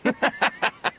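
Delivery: a high, breathy sneering laugh; mocking laugh. Bandit laughing at the silent merchant, mocking. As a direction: laugh down at him, unvoiced and cruel.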